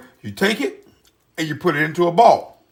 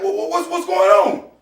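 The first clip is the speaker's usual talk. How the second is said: confused stammer; 10 semitones higher than usual. Retold or imitated. Chef being dragged out.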